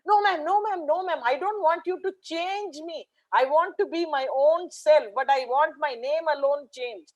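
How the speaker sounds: put-on high, pleading little boy's voice; a small boy begging his teacher not to change him; pleading child. Boy begs the teacher, in a high pleading child's voice.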